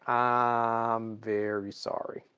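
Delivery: slow, drawn-out sorry; sheepish slow apology. Retold or imitated. Boimler realizes he messed up.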